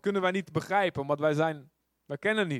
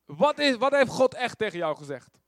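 loud, high insistent question; demanding king; 5 semitones higher, 6 dB louder than usual. Retold or imitated. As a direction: force the truth out of him. Agab demands the real prophecy. (luid en dwingend)

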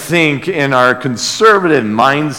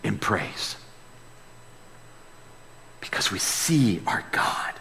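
whisper; 6 semitones lower, 16 dB quieter than usual. Real, not acted. Sermon drops to intimate aside.

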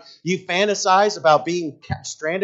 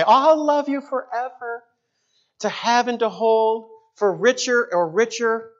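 high, loud lovestruck newlywed parody; hammed-up starry-eyed promise; mock gushing vows; 8 semitones higher, 4 dB louder than usual. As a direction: gush the vows like a dreamy newlywed. Mimics newlyweds' easy wedding vows.

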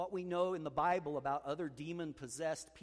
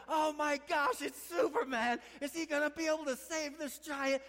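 much higher and louder, gasping wonder; awestruck crowd; 12 semitones higher, 6 dB louder than usual. Retold or imitated. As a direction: stadium crowd spotting Superman, awed and louder. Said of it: "and it's like".

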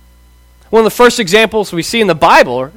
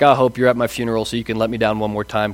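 disappointed dad; 8 semitones lower, 7 dB quieter, faster than usual. Retold or imitated.

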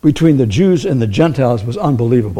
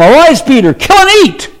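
commanding call from God, high and loud; a preacher booming God's command; 11 semitones higher, 5 dB louder than usual. Retold or imitated. Preacher voices God calling to Peter, commanding. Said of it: "a voice came to him".

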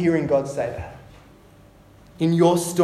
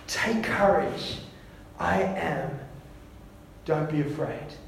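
soft reassurance; 2 semitones lower, 8 dB quieter than usual. Acted. Repeat of Jesus' words, softer.

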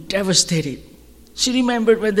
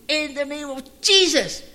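commanding rebuke; 9 semitones higher than usual. Retold or imitated. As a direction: cast the demon out with full force. Team casting out a demon.

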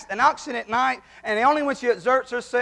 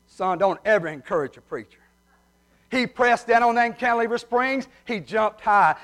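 ordinary talk, nothing marked

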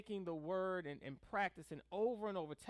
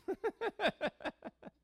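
high rapid chuckle; amused laugh; 10 semitones higher than usual. Real, not acted. Preacher laughing at his own confession, high chuckle. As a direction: laugh it out in quick bursts.

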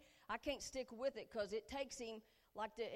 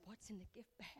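whisper; 2 semitones lower, 12 dB quieter, faster than usual. Retold or imitated. Friends peeking into gift bags.

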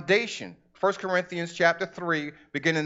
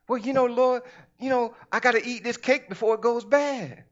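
whiny excuse; 5 semitones higher than usual. Acted. Whiny excuse voiced as believer.

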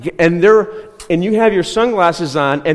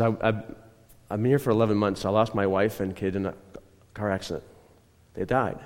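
subdued and low, sinking softer toward the end; retold tearful confession; 6 semitones lower, 7 dB quieter than usual. Stranger at the light tells of his loss, subdued tearful retelling. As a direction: quietly imitate a man fighting tears. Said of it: "He started crying".

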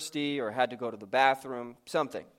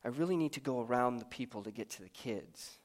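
meek inner voice; 3 semitones lower, 9 dB quieter, faster than usual. Pretend. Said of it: "The first voice is saying".

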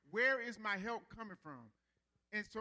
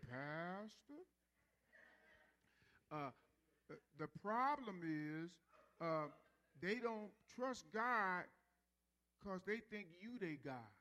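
slow serious therapist; 3 semitones lower, slower than usual. Retold or imitated. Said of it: "He talked real slow".

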